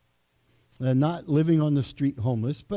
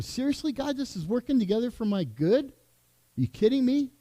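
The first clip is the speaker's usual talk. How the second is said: higher, incredulous complaint to God; exasperated prayer; 7 semitones higher than usual. Retold or imitated. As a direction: demand an answer from God, half joking. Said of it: "I just talked to him".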